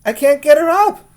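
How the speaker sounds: repeated high and loud in a rush; frantic wail, voice jumping way up; mother panicking about her sleeping daughter; panicked mother. Acted.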